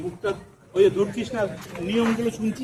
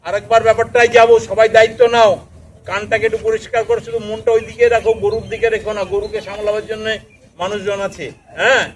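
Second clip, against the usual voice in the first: loud address; 11 dB louder than usual. Real, not acted. Addressing whole group loudly.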